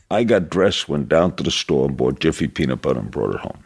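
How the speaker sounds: normal talking voice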